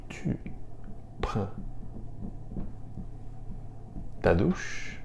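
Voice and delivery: "Tu... prends... ta douche?" with long pauses; long gaps after "Tu" and "prends", rise on "douche"; word by word. Shown as a demonstration. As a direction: softly dictate "Tu prends ta douche?" piece by piece. Teacher models phrase word by word.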